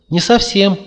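высокий громкий голосок зверька; пискни, как маленький зверёк; пушистый зверёк. A narrator's reading. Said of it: "ответил голос пушистого зверька". (голосок пушистого зверька)

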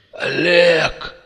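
high, choked dying croak; gurgling. A narrator's reading. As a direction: gurgle out the name. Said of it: "булькнул Макс". (предсмертное бульканье)

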